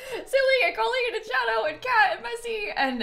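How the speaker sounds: laughing. Real, not acted. Reading sub names.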